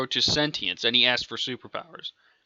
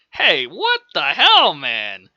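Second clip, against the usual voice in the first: indignant outburst; 7 dB louder, slower than usual. Acted. Man hit by a thrown bullet, indignant yell. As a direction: yell it like you just got hit.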